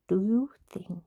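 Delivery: unvoiced hushed whisper. Real, not acted. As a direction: whisper it aside. Whispered aside.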